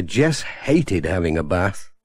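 ordinary delivery, nothing marked

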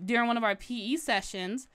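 ordinary delivery

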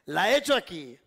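loud, showy reveal of the move; loud announcement. Real, not acted. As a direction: sell the move loudly. Magician announces the trick move.

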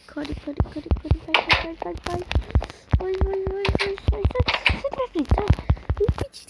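loud, high panicked urging; frantic urging. Real, not acted. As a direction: yell at the wolf to hurry. Urging wolf to run.

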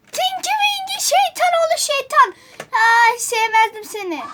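high-pitched outcry, easing down; alarmed recognition. Real, not acted. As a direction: squeal at the dreaded figure. Recognises a scary figure.

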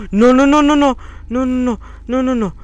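high panicked no's; panicking gamer. Real, not acted. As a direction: shout no in panic. Panic on being chased.

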